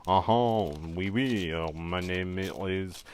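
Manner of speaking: slow, hammed-up French accent. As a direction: play a cartoon Frenchman. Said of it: "I am a Frenchman".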